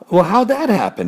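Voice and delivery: high puzzled question; baffled. Acted. Confused pig wonders about his grunts. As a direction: ask bewildered.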